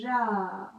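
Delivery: drawn-out ja sounded while writing; stretched syllable. Shown as a demonstration. Teacher to pupil, saying each sound while writing. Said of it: "je dis bien ce que je suis en train d'écrire".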